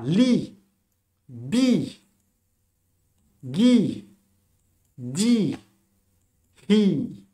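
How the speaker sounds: each syllable "li", "bi", "gi", "di", "hi" said alone, loud and distinct; sounding out syllables. Shown as a demonstration. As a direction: call out each syllable clearly for learners. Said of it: "Le L est un point".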